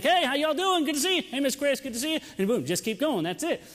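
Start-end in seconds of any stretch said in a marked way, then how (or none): 0.0-2.2 high, bright hallway hello, much higher and louder; popping his head in, chirpy hello; cheery greeting. Retold or imitated. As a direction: sing out hi to the office. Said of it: "I'm like".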